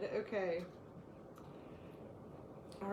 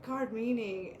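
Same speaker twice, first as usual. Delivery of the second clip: normal talking voice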